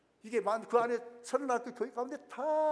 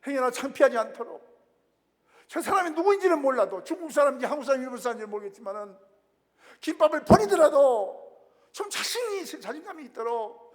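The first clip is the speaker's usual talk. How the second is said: pleading prayer; 6 semitones higher, 11 dB louder than usual. Retold or imitated. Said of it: "울면서".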